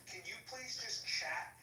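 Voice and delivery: usual speaking voice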